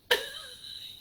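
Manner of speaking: giggling at friends; high squealing laugh. Real, not acted. Cracking up.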